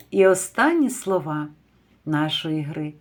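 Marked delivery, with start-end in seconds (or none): none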